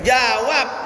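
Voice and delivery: loud prompt to the crowd; preacher shouting at audience to answer; shouted demand. Real, not acted. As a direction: call out sharply for an answer.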